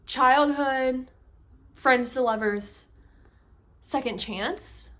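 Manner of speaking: slow, rising unsure second chance; confused reader rechecking the trope; puzzled question. Real, not acted. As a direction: ask it as if doubting the label. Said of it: "it's honestly kinda confusing".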